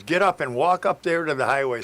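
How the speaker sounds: a cop sending him off to the highway; barked order. Retold or imitated. State trooper ordering the hitchhiker up to the highway, loud and commanding.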